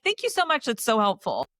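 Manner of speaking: sarcastic mock gratitude, starting high then dropping. Woman answering useless advice from old men, very sarcastically. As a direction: gush fake thanks, dripping with sarcasm. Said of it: "very sarcastically".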